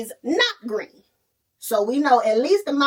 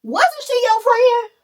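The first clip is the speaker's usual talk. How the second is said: higher, rising excited stop, the last question slowed and louder; urgent interruption; 7 semitones higher, 5 dB louder than usual. Retold or imitated. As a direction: stop her in excitement to ask. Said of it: "they're like".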